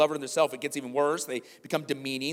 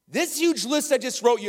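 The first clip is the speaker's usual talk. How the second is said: much higher, louder preaching; full-force preaching; 10 semitones higher, 5 dB louder than usual. Acted. Pastor voicing Paul to the church, full-force preaching. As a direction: press it on them hard.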